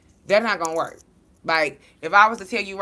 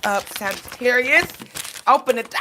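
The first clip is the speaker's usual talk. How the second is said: louder and higher toward the end; playful call; 7 semitones higher, 5 dB louder than usual. Retold or imitated. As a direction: pack up and shout for her to open up. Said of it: "I said".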